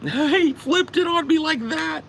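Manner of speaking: very high, slow laughing squeal; laughing disbelief. Real, not acted. Storyteller marvelling at his friend's sudden switch, squeaky laughing disbelief.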